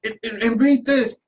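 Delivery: high, loud, slow excited urging; eager teacher. Retold or imitated. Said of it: "And she was like".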